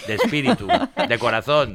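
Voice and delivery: words broken by laughter; laughing. Real, not acted. Dice riendo lo de la Legión. (riéndose)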